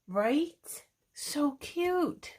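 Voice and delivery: delighted gush. Real, not acted. Shows new layout idea.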